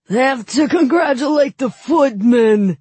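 loud, high roar; roaring nobleman. A narrator's reading. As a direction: roar it with drunken glee. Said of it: "he roared".

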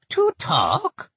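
put-on high, nasal old goblin woman; thick nasal goblin voice. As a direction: pinch it through the nose, goblin. Gertel speaks.

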